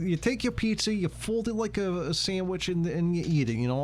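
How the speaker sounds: high-pitched attempt at a New York accent; wobbly New York accent. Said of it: "I can't fucking do my accent right now".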